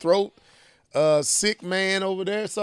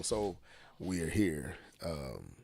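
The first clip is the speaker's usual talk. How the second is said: much lower, soft, hoarse; sick hoarse voice; 13 semitones lower, 16 dB quieter, slower than usual. Shown as a demonstration. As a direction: mimic a sick croaky voice. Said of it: "Let me match his tone".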